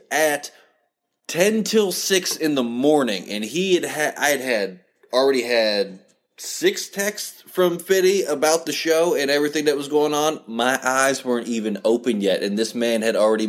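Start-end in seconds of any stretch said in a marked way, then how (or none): none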